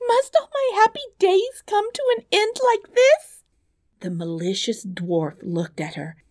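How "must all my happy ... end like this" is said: high, loud wailing princess; despairing cry. Acted. Princess faces the coming lions, high despairing wail. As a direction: lament in panic, pitched far up. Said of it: "she cried".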